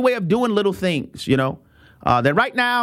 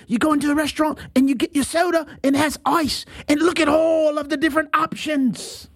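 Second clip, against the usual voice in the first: excited tourist; 6 semitones higher than usual. Retold or imitated. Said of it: "the one guy was like".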